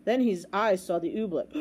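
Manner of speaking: ordinary delivery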